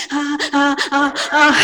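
mock sobbing gasps in short bursts; demo crying. Shown as a demonstration. Demonstrating to the audience how she cries, mock sob. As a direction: show her odd way of crying, hammed up. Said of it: "lloro así".

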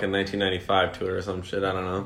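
low, sleepy mumble; just woken up, drowsy; lax voice. Real, not acted. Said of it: "You sound tired".

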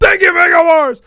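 very high, loud parody yell; hammed-up high shout; mock promo shout. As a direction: belt it out like a cartoonish promo.

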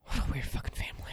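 whispered aside under the breath; breathy whisper. Real, not acted.

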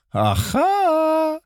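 high, drawn-out "aha"; mock gloating. Singer gloating to pranked prankster, high drawn-out exclamation. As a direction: crow over a payback in a high voice.

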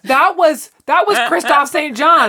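laughing. Real, not acted. Laughing recall of a character.

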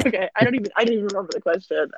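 laughing through the words; giggling at her own joke; cracking up mid-line. Real, not acted. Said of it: "I'm laughing at my own joke".

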